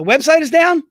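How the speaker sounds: sudden alarm that the site is down; alarmed surprise. Real, not acted. Host alarmed to hear the website is down.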